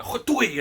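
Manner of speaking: sharp high spit noise; a sudden hock-and-spit in his face; spitting sound. Retold or imitated. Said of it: "I suddenly went".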